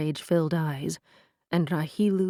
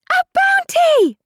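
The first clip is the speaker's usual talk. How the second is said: very high, loud shriek; 13 dB louder than usual. A narrator's reading. Neris shrieking after the fleeing girl, loud. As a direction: scream it after her. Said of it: "Neris shrieked from behind".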